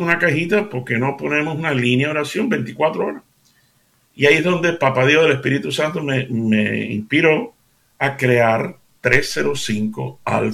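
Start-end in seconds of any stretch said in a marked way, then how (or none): none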